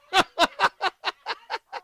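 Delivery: a loud, high burst of laughter; amused, rising laugh. Real, not acted. Laughing at the caller's plan.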